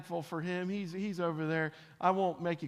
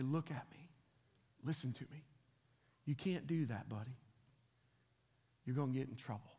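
low, hushed and firm, as to a son; earnest hushed parental warning; serious and quiet; 5 semitones lower, 9 dB quieter than usual. Retold or imitated. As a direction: settle a restless boy with quiet firmness. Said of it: "I'm getting real serious".

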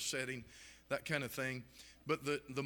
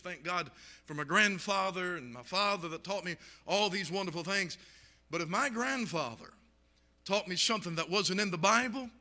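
rising, louder pulpit preaching; preaching at full force; 4 semitones higher, 4 dB louder than usual. Acted. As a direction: drive it home like a revival preacher.

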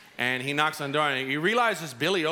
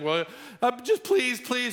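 high, pleading please; begging; 9 semitones higher than usual. Retold or imitated. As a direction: plead desperately, voice jumping up. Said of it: "And he begs the king".

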